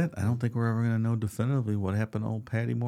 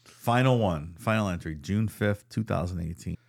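ordinary talk

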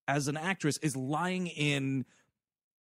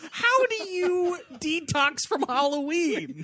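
laughing disbelief; 13 semitones higher, 4 dB louder than usual. Real, not acted. Laughing disbelief at pumpkin story.

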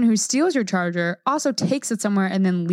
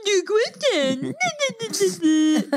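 mocking imitation; 9 semitones higher than usual. Wife mocking what her husband just said, high silly imitation. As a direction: mimic them in a much higher, stretched-out voice. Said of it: "That's what you sound like".

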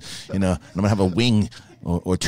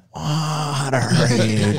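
very slow drawn-out character voice; demonstrating his Sebastian impression to friends; 3 semitones higher, much slower than usual. Shown as a demonstration. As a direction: stretch it out like Sebastian. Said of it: "when I do Sebastian".

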